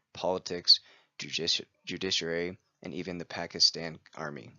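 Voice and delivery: ordinary talk, nothing marked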